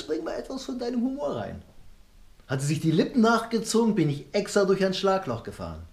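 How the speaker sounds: normal talking voice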